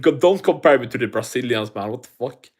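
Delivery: usual speaking voice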